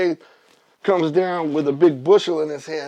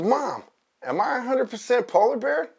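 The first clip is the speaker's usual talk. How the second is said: high little cub voice; baby polar bear; 8 semitones higher than usual. Acted. Baby bear asking his mom, high childlike voice. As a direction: pitch it up like a questioning cub. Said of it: "he asks his mom".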